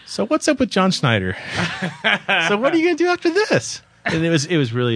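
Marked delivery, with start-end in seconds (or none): 0.0-1.3 lifted, high-pitched WHAT'S UP question; chirpy mock re-read of an interview question; varied question inflection. Host demonstrating his re-recorded questions, exaggerated inflection. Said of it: "tried to change the inflection every time". 2.5-3.8 very high, exaggerated DO AFTER THIS; squeaky send-up of an interviewer's question; varied question inflection. Host mocking his own re-recorded questions, over-the-top high inflection. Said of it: "tried to change the inflection every time".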